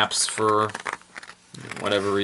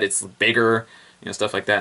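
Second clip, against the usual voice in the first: usual speaking voice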